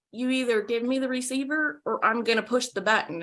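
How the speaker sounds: hushed, retold ultimatum. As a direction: say it softly, as a firm threat under the breath. Retold quiet threat to mother.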